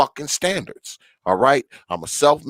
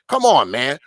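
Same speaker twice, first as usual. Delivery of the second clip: ordinary delivery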